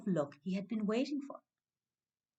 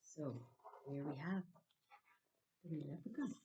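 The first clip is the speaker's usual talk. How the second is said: much softer, confiding to the listener; hushed aside; 4 semitones lower, 10 dB quieter than usual. A narrator's reading. Storyteller softly pointing out the wee leprechaun to listeners. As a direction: lean in and whisper it.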